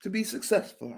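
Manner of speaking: slow, drawn-out sermon cadence; stretched pulpit delivery; preacher voice. Acted. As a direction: preach it to the congregation. I feel like preaching up in here.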